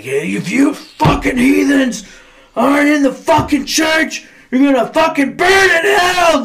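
high, slow, shouted hellfire sermon; mock hellfire preacher yell. Pastor imagined yelling at heathens from the pulpit, mock fire-and-brimstone shout. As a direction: bellow damnation at the congregation. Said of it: "He's not, like, up there like".

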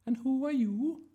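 high, loud sing-out teacher voice; fussy drama teacher. A narrator's reading. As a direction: call the class to order, high-pitched. Voices teacher Miss Darling.

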